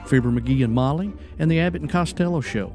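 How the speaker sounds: ordinary talk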